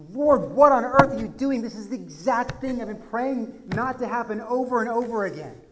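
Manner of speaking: loud, high anguished plea; exasperated cry heavenward; crying out to God. Retold or imitated. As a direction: cry out in dismay at the Lord.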